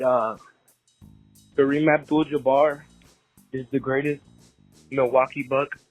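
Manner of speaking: recorded phone message; leaving a voicemail. Real, not acted. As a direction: voicemail played over the air. Caller's voicemail played on show.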